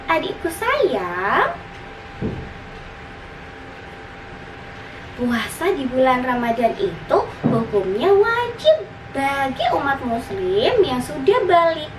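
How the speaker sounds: high, lilting big-sister voice; explaining big sister. Acted. Older sister explains Ramadan fasting to her little brother, high explaining voice. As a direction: explain it sweetly, stretching the key words. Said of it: "jelas sang kakak".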